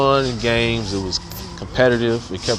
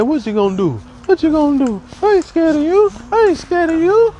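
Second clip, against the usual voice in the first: high squeaky taunt, much higher and louder; mock argument; 4 dB louder than usual. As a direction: squeal a challenge at a kid. Imitating teacher arguing with kid.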